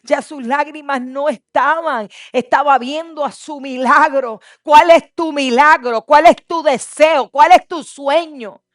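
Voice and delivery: loud, rising sermon build; fervent preaching. Acted. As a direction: preach it with full fire. Preaching at full force.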